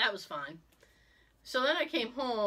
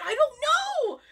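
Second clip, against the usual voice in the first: exasperated cry; 8 dB louder, slower than usual. Retold or imitated. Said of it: "I said".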